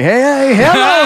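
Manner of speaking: high, hammed-up hello called upward; playful high-pitched holler; mock excited greeting.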